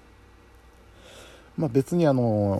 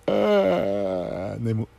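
yawning through the words; much slower than usual. Real, not acted. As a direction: say it mid-yawn. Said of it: "あくびも出たところで".

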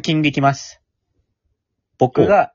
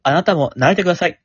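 normal talking voice

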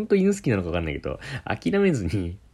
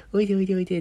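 much higher coaxing call to a dog; mock coaxing aunt; 12 semitones higher, much faster than usual. Aunt beckoning the dog through the mirror, high mock coaxing.

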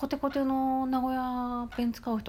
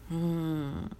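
normal talking voice

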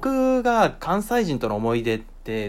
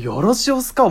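higher, lilting Kyoto よろしおすか; a hint of Kyoto-ben; 京都弁の口まね; Kyoto dialect imitation; 8 semitones higher, 3 dB louder than usual. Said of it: "衝撃を受けちゃって".

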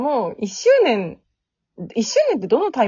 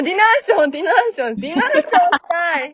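excited; 6 semitones higher, 4 dB louder, much faster than usual. Real, not acted. Excited repeating of the word.

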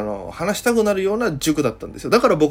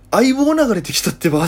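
put-on higher, louder girl blurting the reveal; Okinawan girl punchline; 7 semitones higher, 5 dB louder than usual. Girl revealing Aibou came on, higher and louder. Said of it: "ふーんってかましてくるんですよ".

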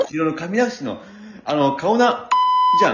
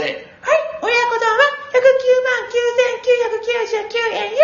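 much higher, slow puppet clerk; high shop clerk voice; slower than usual. Acted. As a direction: quote the price in a squeaky voice.

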